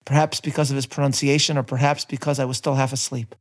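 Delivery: ordinary talk, nothing marked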